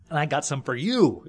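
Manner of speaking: put-on a boy proudly handing over food; eager little boy. Boy offering food to mom.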